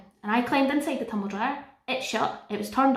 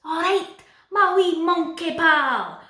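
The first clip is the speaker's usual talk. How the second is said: high, loud, broad Scottish Dora voice; Dora in full Scottish accent; 8 semitones higher, 7 dB louder, slower than usual. Pretend. Dora speaking to Boots in a loud, high broad Scottish accent. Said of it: "Dora in a full Scottish accent".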